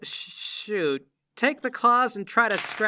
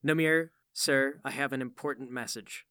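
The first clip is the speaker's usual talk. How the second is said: mock polite; 6 semitones lower, 5 dB quieter than usual. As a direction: purr it politely before striking. Mock-polite setup before attack.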